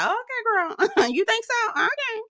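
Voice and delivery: high, laughing mock okay; imitating an awkward pause, laughing. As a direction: play the awkward silence with a squeaky okay.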